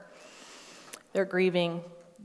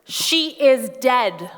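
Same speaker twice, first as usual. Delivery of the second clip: slow, higher, louder pleading; mock frustrated outburst, higher and louder; exasperated; 7 semitones higher, 7 dB louder, slower than usual. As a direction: shake sense into him with each word. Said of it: "I would have gotten physical with Jesus".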